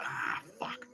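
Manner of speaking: soft, breath-only groaned curse; retold sinking reaction, whispered; hushed dismay. Teller reacting to the colleague's advice, hushed dismay.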